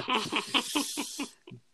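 high breathy laugh. Real, not acted. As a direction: break into a laugh. Laughs after own remark.

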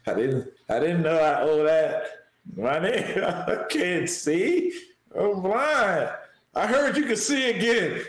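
lightly put-on southern drawl as the blind mom; southern twang. Host playing the mom dodging taxes, light southern twang. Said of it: "That's my southern twang".

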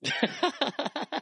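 high, delighted laugh at the punchline; high burst of laughter. Real, not acted. Host laughs hard at his cohost's joke punchline.

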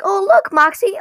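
high, loud mock excitement; imitating an excited cartoon character; excited exclamation. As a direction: point it out eagerly to your partner. Acting Millie's excited pointing.

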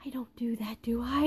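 hushed, doubting whisper; whispering to yourself. Acted. Whispering to yourself at three A M, doubting whisper. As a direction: whisper it privately into the dark. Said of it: "whispering to yourself in the dark".

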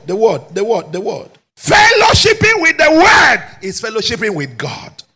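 loud, high, ringing pulpit shout; full-force preaching. Acted. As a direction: rouse the congregation to fight. Preaching climax.

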